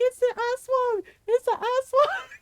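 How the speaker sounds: high-pitched mock terror; screaming in panic. Little brother warning of the aswang in the hall, mock screaming. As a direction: cry out like a little boy who sees a monster. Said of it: "he starts screaming".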